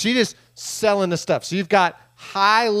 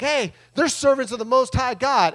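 light, higher-pitched mock announcement; lightly voiced girl calling out, pitched up; imitated slave girl; 7 semitones higher than usual. Slave girl pointing out Paul's group to everyone, lightly mimicked high call. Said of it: "this girl's like".

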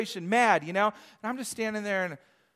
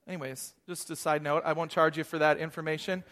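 ordinary talk

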